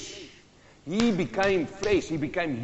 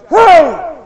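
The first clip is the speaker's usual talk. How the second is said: high, full-force acted shout; shouted warning; 11 semitones higher, 13 dB louder than usual. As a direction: yell a danger warning down a hole. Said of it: "he stood there and he shouted".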